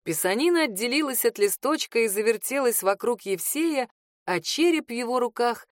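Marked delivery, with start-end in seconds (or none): none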